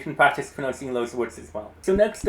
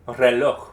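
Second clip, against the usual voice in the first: Reloj called out louder as re-LOJ; final-syllable stress; 2 semitones lower, 5 dB louder than usual. Shown as a demonstration. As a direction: punch the last syllable of Reloj. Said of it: "I'm going to say a word".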